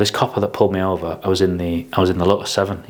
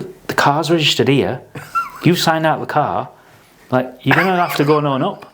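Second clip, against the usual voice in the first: higher, firm insisting mentor; insistent mentor; 7 semitones higher than usual. Retold or imitated. Mentor telling apprentice to confess, higher firm insistence. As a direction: push him to own up.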